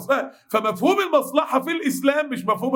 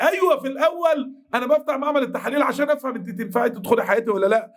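normal talking voice

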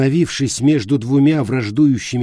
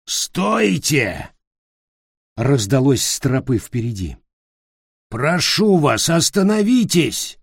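calling out; 3 semitones higher than usual. Acted. Goat creature calls out.

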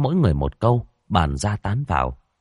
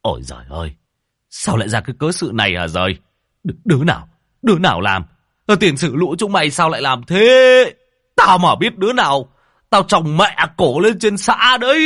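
rising from a wail to a high, loud yell; 8 semitones higher, 6 dB louder than usual. A narrator's reading. Village chief yelling at the ruins, wailing then shouting. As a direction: cry out over the wrecked shrine, then roar. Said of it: "ông Long gào lên".

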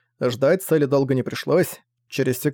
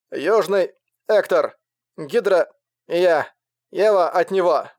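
higher, slow, chopped by static; crackly cordless phone; 4 semitones higher, slower than usual. A narrator's reading. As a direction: shout through a failing radio. Broken radio transmission.

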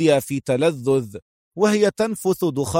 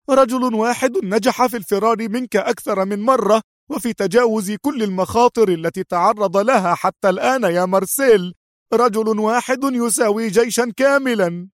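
sharp exclaiming; 7 semitones higher than usual. A narrator's reading. Sonia insisting on the enemy's danger, sharp and high. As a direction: insist fiercely, higher. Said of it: "هتفت سونيا في حدة".

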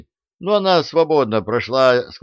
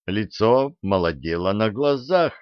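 usual speaking voice

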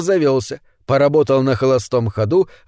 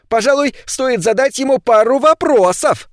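pompous neighbour, voiced higher; self-important; 10 semitones higher, 3 dB louder than usual. A narrator's reading. Charlie rallying the neighbours, pompously. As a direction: puff up like a man taking charge. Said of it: "важно заявил Чарли Франсуорт".

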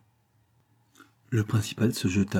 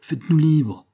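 louder, higher cry to the king; 7 semitones higher, 5 dB louder than usual. A narrator's reading. The starving people calling to their king, crying out. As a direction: raise the voice in a plea. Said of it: "cria à son roi".

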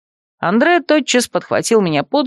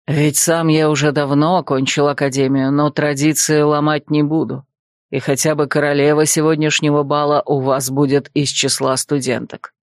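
put-on lower, composed older man; lower male voice; 5 semitones lower than usual. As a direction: voice him lower and calm. Andre speaks.

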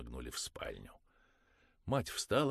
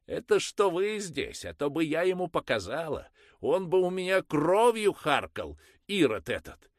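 much higher and louder threat; furious threat; 13 semitones higher, 12 dB louder than usual. Acted. Mother threatening her son before the priest, loud. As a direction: threaten the boy fiercely.

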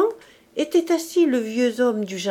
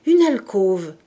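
inner exclamation; 7 dB louder than usual. A narrator's reading. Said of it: "s'écria intérieurement Gavroche".

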